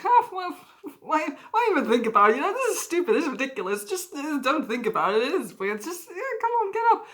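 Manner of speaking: high, wild, manic self-talk. Retold or imitated. Talking himself up off the floor, high and manic. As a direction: brush it all off with manic cheer. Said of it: "very manic, upsetting kind of laugh".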